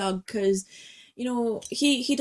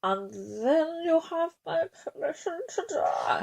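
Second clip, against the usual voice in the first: slow, high, softer masked-villain voice; Bane impression; 7 semitones higher, 5 dB quieter, slower than usual. Shown as a demonstration. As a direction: draw out each word as if through a mask. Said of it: "hold your, your Bane mask".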